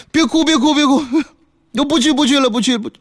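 hurried comforting husband; husband soothing. Acted. Husband consoles his weeping wife, soothing. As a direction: hush her tears quickly.

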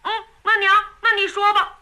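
put-on the child who got his way; satisfied boy. A narrator's reading.

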